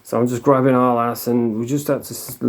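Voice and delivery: normal talking voice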